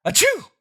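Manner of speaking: big high fake sneeze; hammed-up sneeze; loud sneeze. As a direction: blast out a sneeze. Sneeze in comic.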